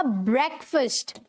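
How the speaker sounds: ब्रेकफास्ट as "BREK-fust"; short e and reduced second vowel in ब्रेकफास्ट; correct pronunciation. Shown as a demonstration. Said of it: "बोला जाएगा".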